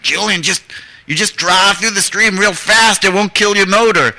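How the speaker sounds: high, loud, drawled-out country urging; imitated urging holler. Woman urging filmmaker to drive the stream, imitated high holler.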